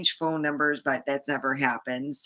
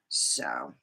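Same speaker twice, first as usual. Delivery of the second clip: whispered so; 8 dB quieter than usual. Real, not acted. Whispered aside.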